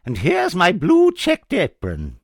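very high, loud witch voice. Acted. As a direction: shriek it like a witch. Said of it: "said the witch".

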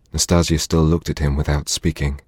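ordinary delivery, nothing marked

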